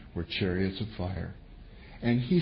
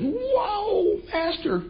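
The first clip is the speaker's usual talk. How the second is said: high startled whoa, much higher pitch; startled cry; 3 dB louder, much slower than usual. Retold or imitated. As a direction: gasp it out like a scared young servant. Servant sees surrounding army.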